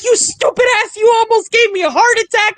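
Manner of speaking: high, worked-up mom voice; mock scolding. Startled mom telling off her surprise visitor, mock-angry.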